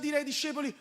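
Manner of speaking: ordinary delivery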